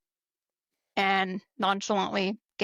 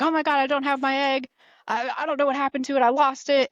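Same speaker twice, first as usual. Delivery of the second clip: higher, louder panicky mock wail; 6 semitones higher, 4 dB louder than usual. Airman retold finding his egg gone, higher mock panic. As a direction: play the airman who lost his grenade, panicking. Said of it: "he comes back and he's like".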